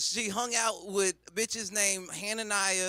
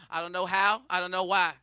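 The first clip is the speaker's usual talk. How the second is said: normal talking voice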